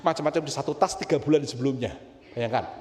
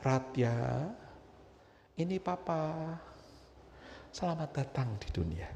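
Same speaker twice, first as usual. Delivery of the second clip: soft, tender welcome to a newborn; sapaan papa pertama; 9 dB quieter than usual. Retold or imitated. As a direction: speak gently to your baby, with long pauses. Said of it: "Saya panggil". (suara ayah lembut menyambut bayi)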